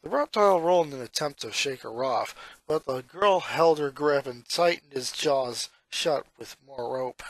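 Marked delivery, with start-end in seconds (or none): none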